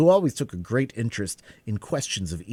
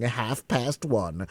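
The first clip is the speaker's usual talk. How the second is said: ordinary delivery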